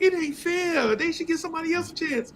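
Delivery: high, fast whiny schoolkid complaint; high whiny complaint about fairness; whiny kid. Retold or imitated. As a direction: whine it like a sulking kid. Whiny classmate Jermaine voiced.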